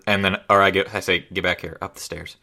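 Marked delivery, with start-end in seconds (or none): 1.3-2.4 soft, hushed in-character message; whisper. Acted. Player repeats the whispered message to Shamdar, hushed. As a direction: quietly send a secret order. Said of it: "uh, whisper".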